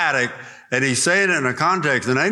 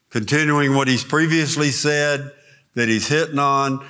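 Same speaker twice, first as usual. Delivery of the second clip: ordinary talk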